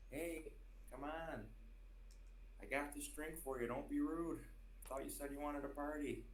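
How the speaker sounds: Jeffrey Dahmer impression. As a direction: coax him to drink with a creepy lure. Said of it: "your Jeffrey Dahmer".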